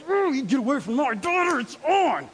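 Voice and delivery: high-pitched protective dad outburst, acted; mock angry threat. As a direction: play the riled-up dad warning off a dog.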